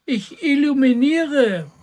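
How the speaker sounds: high, loud cat's announcement; calling down. A narrator's reading. Little cat in the tree answering the boy, calling down. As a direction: call it down proudly from up high. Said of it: "rief die kleine Katze herunter".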